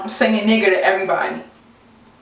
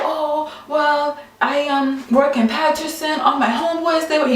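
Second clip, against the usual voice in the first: light mock Italian accent, pitched higher; a touch of Italian accent, higher pitch; imitating Italian accent; 6 semitones higher than usual. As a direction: retell his words in a hint of an Italian accent. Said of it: "he has an, an Italian accent".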